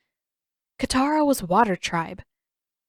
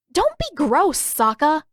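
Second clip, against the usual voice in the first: higher, louder, grossed-out; disgusted scolding; 8 semitones higher, 5 dB louder, slower than usual. A narrator's reading. Katara scolds her brother for being gross, disgusted. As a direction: chide him in disgust.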